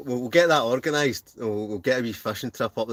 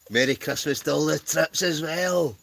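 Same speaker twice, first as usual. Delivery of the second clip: comic voice; 6 semitones higher than usual. Acted. Repeats the greeting in a comic voice.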